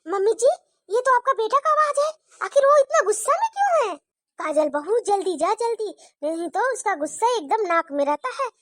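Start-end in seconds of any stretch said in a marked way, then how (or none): 0.1-3.9 high, fast, anxious daughter-in-law; young bahu startled by her husband's anger; worried young wife. Acted. Daughter-in-law hears angry husband. (घबराई हुई बहू, ऊँची तेज़ आवाज़)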